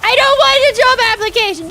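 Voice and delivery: hammed-up refusal shouted; mock panic shout. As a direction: scream it while running away. Fleeing from adulthood in jest.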